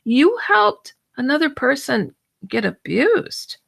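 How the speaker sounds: mild mock horror; shocked realization. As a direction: play back your own dismay, half-acted. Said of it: "I was like".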